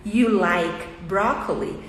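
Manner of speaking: "you like broccoli" said slowly, word by word; each word full and apart, falling at the end; separated words. Shown as a demonstration. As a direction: spell out "you like broccoli" at a careful, slow pace. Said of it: "o do".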